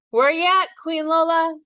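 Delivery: answering the greeting as he opens the door; calling back. A narrator's reading. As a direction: call back to her cheerfully. Greeting call answering Lola.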